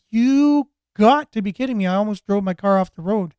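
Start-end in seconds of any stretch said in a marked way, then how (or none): 0.1-1.9 loud disbelieving outburst; disbelief. Retold or imitated. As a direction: shout it in disbelief. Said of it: "I was like".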